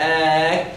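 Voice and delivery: آك with the tongue back lightly released; showing the kaf point; kaf articulation. Shown as a demonstration. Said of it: "تقول إيه؟".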